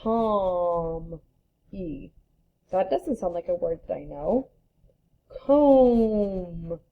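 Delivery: "Calm", "E", "Comb", sounding out come the wrong way, letter by letter. Shown as a demonstration. Teacher pretending to sound out come wrongly, puzzled. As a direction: try to sound out "Comb" as if you forgot it's a sight word. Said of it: "I might try and sound it out".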